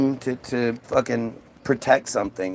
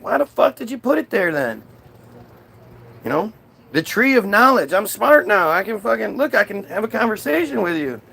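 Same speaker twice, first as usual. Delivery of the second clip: higher-pitched comic protest; arguing Adam; 6 semitones higher than usual. Acted. Adam talks back to God, arguing. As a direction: argue back, pleased with your new smarts.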